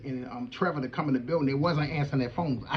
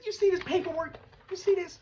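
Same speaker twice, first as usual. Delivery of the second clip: pitched way up, waving the papers; can you believe this, high and urgent; excited disbelief; 14 semitones higher than usual. Retold or imitated. Showing the paperwork to Jamal, excitedly high.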